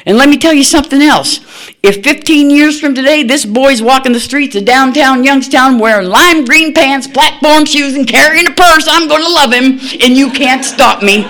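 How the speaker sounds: high, loud, fast defiant declaration; fierce defiance. Retold or imitated. Grandmother defying her daughter over the boy, loud and high. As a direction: throw it at her like a challenge. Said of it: "she knows I'm mad".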